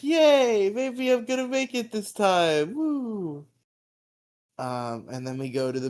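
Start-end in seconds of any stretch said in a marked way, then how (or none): 0.0-3.5 much higher, louder, mock cheer; excited kid. Retelling Roger's joy at a second tryout, high mock cheer. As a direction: squeal it like a thrilled kid.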